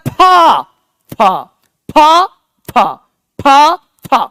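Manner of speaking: फा as separate punched syllables, contrasting lip and teeth sounds; sound demonstration. Shown as a demonstration. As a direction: drill फा one syllable at a time. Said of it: "हम लोग बोलते हैं". (फा का उच्चारण, अलग-अलग करके)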